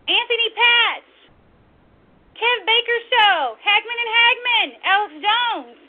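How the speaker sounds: loud, high-pitched roll call of allies; rousing shout-out. Real, not acted. Host to fellow broadcasters she supports, rousing shout-out. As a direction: call out each name with full-throated support.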